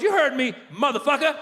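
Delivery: high-pitched cheeky comeback; defiant young son. Retold or imitated. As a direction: talk back like a foul-mouthed kid. Son talks back to dad.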